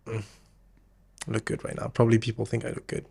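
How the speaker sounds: softly murmured self-approval; self-satisfied inner voice. Acted. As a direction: quietly admire yourself, half under your breath. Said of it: "when I get a haircut ... I'm like".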